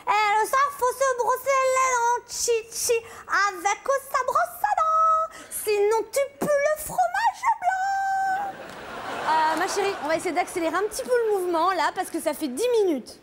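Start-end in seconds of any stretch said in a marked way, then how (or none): 0.1-8.5 put-on high whiny little girl voice; child voice. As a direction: whine like a small child stalling bedtime. Child voice whining at bedtime. (voix de petite fille geignarde)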